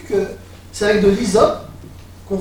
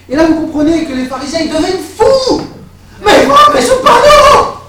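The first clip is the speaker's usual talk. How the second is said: building outraged cry; 13 semitones higher, 12 dB louder than usual. Acted. Preacher acting the pharisees' fury before the church, loud and high. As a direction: swell up into a scandalised shout.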